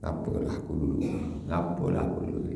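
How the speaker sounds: hushed, whispered lament; a penitent whispering regret; regretful whisper. Retold or imitated. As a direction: sigh out regret for past sins. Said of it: "dia teringat dosanya".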